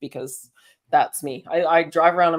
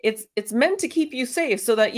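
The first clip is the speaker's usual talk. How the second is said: ordinary delivery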